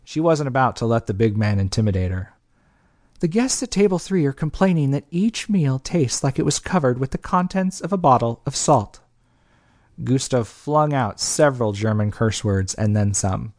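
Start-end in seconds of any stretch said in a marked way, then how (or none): 3.2-9.0 higher, lightly voiced young woman reporting a complaint; Belle, the manager. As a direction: voice Belle, brisk and businesslike.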